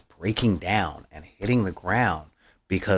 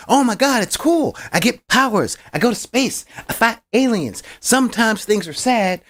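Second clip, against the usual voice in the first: very high, hammed-up hero excitement; mock gushing; 13 semitones higher, 4 dB louder than usual. Mocking Marvel movie heroes bragging of powers, gushing parody. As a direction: parody an overexcited superhero rattling off perks.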